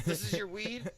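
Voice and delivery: high, loud disbelief; incredulous question. Retold or imitated. Officer asking the driver about his tiny baggie, incredulous.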